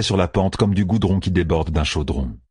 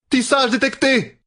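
much higher, slow alarmed cry; a woman alerting allies to a weave. A narrator's reading. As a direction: warn the others at full pitch. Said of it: "cria Pevara".

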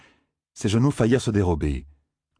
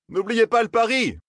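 high-pitched call to opponents; calling across, very high voice. A narrator's reading. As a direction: throw it out to them, pitch way up. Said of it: "leur lança-t-il".

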